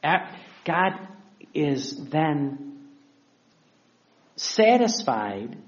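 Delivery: normal talking voice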